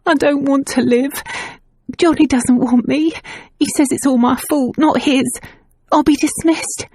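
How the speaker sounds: tearful confession. A narrator's reading. Nan crying, confessing.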